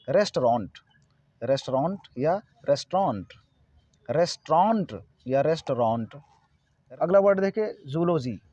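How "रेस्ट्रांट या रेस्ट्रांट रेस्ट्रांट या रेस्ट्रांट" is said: "रेस्टोरेंट" slowly as res-traant; correct restaurant. Shown as a demonstration. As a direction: repeat "रेस्टोरेंट" drawn out, with traant for to-rent. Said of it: "इसका सही उच्चारण होना चाहिए". ("रेस्टोरेंट" का सही उच्चारण, धीरे, रेस-ट्रांट)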